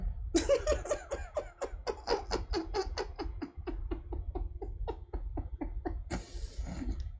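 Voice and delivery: long high wheezing laugh. Real, not acted. Streamer tickled by a county nickname, wheezing laugh. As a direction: wheeze with laughter till it fades.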